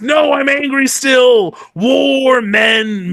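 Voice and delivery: hammed-up warrior bellow, slow; mock angry bluster. Lords at the war council, hammed-up angry shouting. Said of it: "And they're all like".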